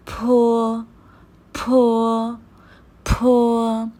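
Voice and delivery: फो with a strong puff of air from the lips; breathy aspirated फो, air pushed out; teacher models फो with air bursting from the lips. Shown as a demonstration. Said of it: "फो में ... हवा आनी चाहिए होंठों से".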